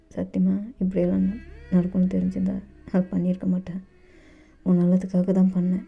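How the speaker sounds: usual speaking voice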